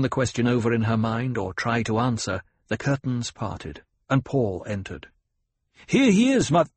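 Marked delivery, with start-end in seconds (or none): 5.9-6.6 high, loud call cut short; breaking off. A narrator's reading. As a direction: call out, then stop dead. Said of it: "Paul broke off".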